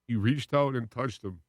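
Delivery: ordinary talk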